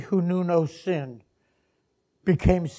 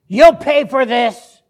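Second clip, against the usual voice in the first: loud, high-pitched slow threat; vengeful threat; 9 semitones higher, 18 dB louder, slower than usual. Acted. Preacher voices words Jesus never said, vengeful threat. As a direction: thunder it out as a warning. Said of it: "And his first words were".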